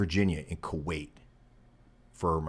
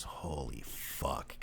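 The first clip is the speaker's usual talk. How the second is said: low, hushed, drawn-out disbelief; slow and soft, as if it is dawning; stunned near-murmur, stretched out; retold stunned recognition; 5 semitones lower, 12 dB quieter, much slower than usual. Instructor stunned at recognizing former recruit.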